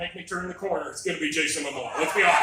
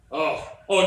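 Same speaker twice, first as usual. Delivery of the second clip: ordinary talk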